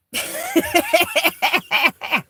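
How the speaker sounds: a high, giddy burst of laughter. Real, not acted. Gushing over her friend, a high giddy laugh.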